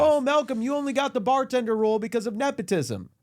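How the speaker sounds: high whiny accuser voice; mock accuser. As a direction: whine the accusation in a high mocking voice. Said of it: "Nepo friend allegations".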